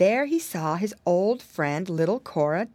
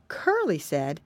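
ordinary delivery, nothing marked

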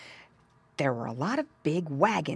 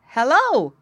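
high, loud piglet hello; raised, surprised greeting; exclaimed greeting; 8 semitones higher, 9 dB louder than usual. A narrator's reading. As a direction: exclaim it to a strange animal. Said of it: "Squinty finally exclaimed".

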